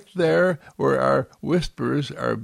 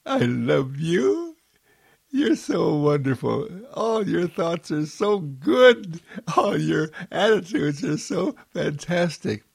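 adoring voice; 5 semitones higher than usual. Acted. The world embraces you and answers, high adoring voice. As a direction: gush lovingly in a high put-on voice. Voicing the world's loving reply.

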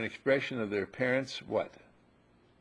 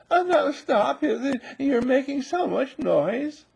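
high, slow, weak pleading; ineffectual owner; 14 semitones higher, 6 dB louder, slower than usual. Retold or imitated. Teacher mocks a weak owner scolding a puppy, high and feeble. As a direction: plead feebly with the dog, no force at all. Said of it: "an ineffectual".

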